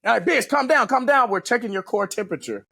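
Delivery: very high, urgent mock calming voice; imitated urgent instructor. Instructors restraining a fighting trainee, imitated high urgent calming. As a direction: pitch it way up, talking down a struggler.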